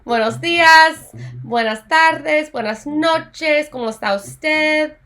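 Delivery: slow, careful teacher model; slow formal greetings. Shown as a demonstration. Spanish teacher modeling formal greetings slowly. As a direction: spell the greetings out slowly for the class. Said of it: "remember we talked about formal versus informal greetings".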